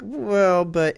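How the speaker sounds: high, doubtful hedging; hesitant wavering. Retold or imitated. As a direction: waver aloud, pitched well up. Wavering over a sale price.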